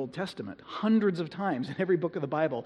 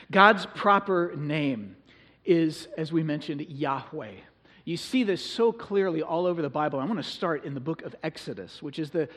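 ordinary delivery

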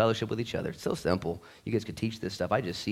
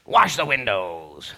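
mock chore order; 6 dB louder, much slower than usual. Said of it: "He could have showed up".

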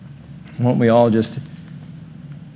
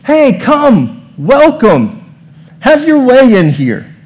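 higher, louder, slow warm welcome; welcoming host; 13 semitones higher, 8 dB louder, much slower than usual. Retold or imitated. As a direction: greet a guest at the door with open arms. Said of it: "you saying".

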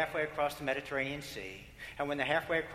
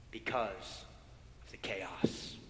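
hushed whisper; 6 semitones lower, 5 dB quieter, slower than usual. Acted. Preacher whispers of the chaos to the congregation. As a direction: drop to a low whisper on the chaos.

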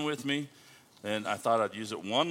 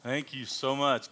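normal talking voice

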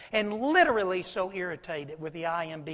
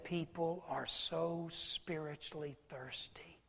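hushed; 11 dB quieter, slower than usual. Retold or imitated. Quoting pastor, hushed.

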